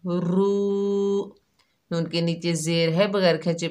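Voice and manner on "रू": रू with a thick heavy r and long oo. Shown as a demonstration. Teacher shows the heavy रॉ in रू, thick r. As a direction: make the r in रू full and heavy. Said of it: "रॉ को मोटा पढ़ेंगे".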